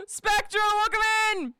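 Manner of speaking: high, loud welcome call; excited greeting. Real, not acted. Streamer greeting a new viewer, excited shout.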